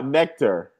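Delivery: ordinary talk, nothing marked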